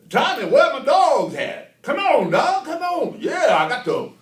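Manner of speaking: high, drawn-out hollering; loud character voice. Acted. Calling out to Tommy about his dogs and horses, hollering. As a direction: shout it high and slow, full force. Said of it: "let's see, he's".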